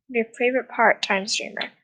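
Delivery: normal talking voice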